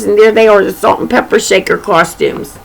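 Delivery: ordinary delivery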